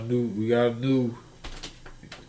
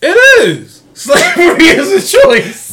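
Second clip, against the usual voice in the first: high, loud, laughing disbelief; laughing mock outrage; 12 semitones higher, 8 dB louder than usual. Real, not acted. Host mocks the rapper's suit, laughing high and loud. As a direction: squeal it out through laughter.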